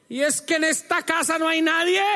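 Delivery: mother scolding a family watching TV, sarcastic; sarcastic mom. Retold or imitated. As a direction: mimic a fed-up mom's sarcastic question. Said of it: "Pregunta sarcástica".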